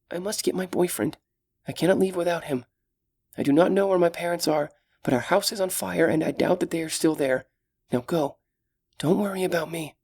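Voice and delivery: put-on gentle, caring young elf; young elf woman. Solana's voice held.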